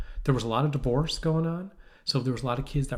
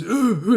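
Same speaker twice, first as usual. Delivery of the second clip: loud, high imitated exertion grunts; workout grunts; 9 semitones higher, 9 dB louder than usual. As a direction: grunt as if punching the bag. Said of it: "making noise".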